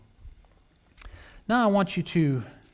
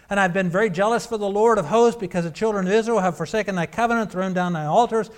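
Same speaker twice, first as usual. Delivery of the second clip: scripture reading; 3 semitones higher, 7 dB louder than usual. Acted. Reads Elijah's complaint aloud.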